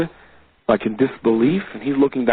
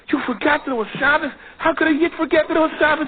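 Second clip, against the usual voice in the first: high-pitched incredulous reproach; aghast, high questioning voice; shocked storeowner; 13 semitones higher, faster than usual. Acted. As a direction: exclaim it in scandalized disbelief, pitched way up. Storeowner shocked in retold story.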